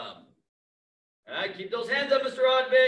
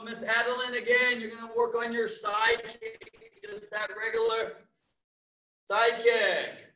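usual speaking voice

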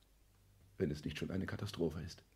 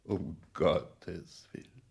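dismayed groan; 4 dB louder, slower than usual. Acted. Dismayed outcry.

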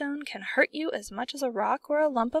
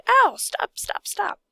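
yelping, breathless pleas; cry of pain; 10 semitones higher, 5 dB louder, slower than usual. A narrator's reading. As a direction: beg them to stop hurting. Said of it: "he cried".

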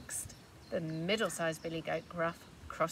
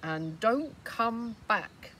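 gruff warning; 2 semitones higher, 6 dB louder, much slower than usual. Acted. Troll warns goat.

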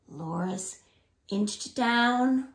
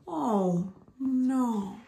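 ordinary delivery, nothing marked

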